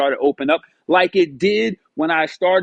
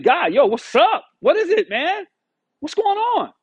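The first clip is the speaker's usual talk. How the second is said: pleading upward, higher and higher; exasperated plea; 13 semitones higher than usual. Retold or imitated. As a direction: throw the question up at God, fed up. Frustrated plea to God.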